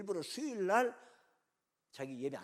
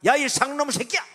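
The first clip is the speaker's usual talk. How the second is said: furious yelled insult down the phone; angry shout; 10 semitones higher, 17 dB louder than usual. Retold or imitated. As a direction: scream the curse, high and loud. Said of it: "그러는 거예요".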